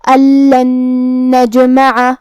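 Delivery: الن held with a nasal hum into نجمع; nasal ghunna. Shown as a demonstration. Teacher demonstrating idgham with ghunna. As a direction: merge the noon with ghunna and shaddah. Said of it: "غنہ اور تشدید کے ساتھ".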